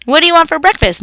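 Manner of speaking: shouting mom. A narrator's reading. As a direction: call it out across the room. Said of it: "Mom shouted".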